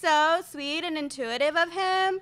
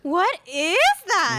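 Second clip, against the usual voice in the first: shocked question; 6 dB louder, slower than usual. Acted. Sister catches brother.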